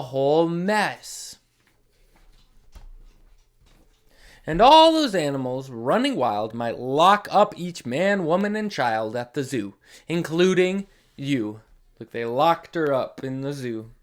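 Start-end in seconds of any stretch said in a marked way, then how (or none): 0.0-11.6 lively, sing-song read-aloud; animated storybook voice with dramatic pauses; reading to children. Acted. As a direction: read it big for the little ones. Reads picture book to children.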